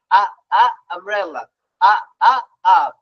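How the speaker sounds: short separated "আ, আ" before "আমব্রেলা" and "আপ"; phonics U sound. Shown as a demonstration. Teacher drilling the U sound, isolated "আ" before each word. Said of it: "পড়েন কি?".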